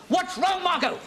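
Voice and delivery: high, loud, frantic question; hysterical shouting. Acted. Husband begging the neighbour for news, hysterical shouting. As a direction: yell it down the phone in panic. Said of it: "one to respond to Latinesque hysteria".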